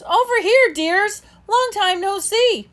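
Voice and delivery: high, loud gleeful greeting; delighted call. A narrator's reading. Snickers, delighted, calls to her friends across the water. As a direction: call out to old friends with joy. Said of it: "calls in delighted voice".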